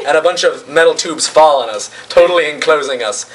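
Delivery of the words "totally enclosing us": plain reading voice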